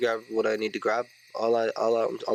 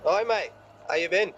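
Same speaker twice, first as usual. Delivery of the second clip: cheeky greeting; 7 semitones higher than usual. Acted. Greeting villain in character.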